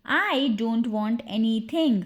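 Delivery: slow, stretched teaching take; deliberate language-drill repetition; slow repeat. Shown as a demonstration. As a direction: say it slowly so learners can follow. Drill, slower repeat for learners.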